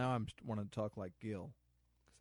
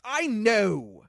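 much higher and loud, sharply snooty; haughty retort; 10 semitones higher, 13 dB louder than usual. Retold or imitated. Gil told where the bathroom is, high and snooty. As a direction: snap it back like an offended butler. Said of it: "And he goes".

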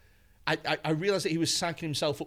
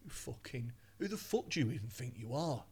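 much softer, menacing snarl; low menacing threat; 4 semitones lower, 10 dB quieter than usual. Retold or imitated. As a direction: threaten him quietly. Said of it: "so he's like".

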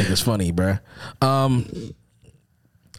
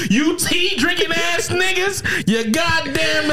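laughing mock insult; 7 semitones higher, much slower than usual. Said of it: "He said".

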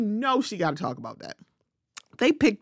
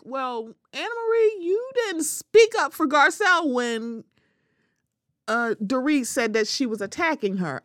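indignant imagined retort; 5 semitones higher than usual. Retold or imitated. Imagined retort to Anna Marie.

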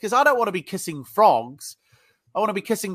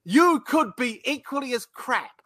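retold anger; 5 semitones higher than usual.

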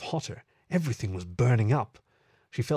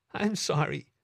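higher, understated tearful voice; tearful apology; 4 semitones higher than usual. A narrator's reading. Meadow apologizes to her lover, tearful. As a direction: apologize while holding back tears. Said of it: "She couldn't hold back the tears".

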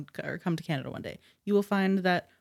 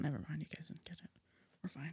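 sulky, much softer under-the-breath whisper; whispered aside; 17 dB quieter, faster than usual. Real, not acted. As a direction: mutter it to yourself, fast. Sulky aside after failed joke.

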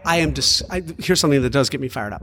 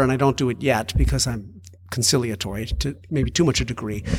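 normal talking voice

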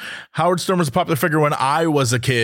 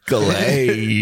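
"Clay." said low and drawn out; low host impression; 8 semitones lower than usual. Cohost echoing the host's greeting, low drawn-out voice. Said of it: "He'd be like".

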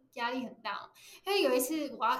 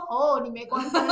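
laughing quote; 8 dB louder than usual. Retold or imitated. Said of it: "然后就说：".

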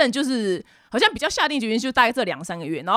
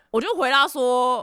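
ordinary talk

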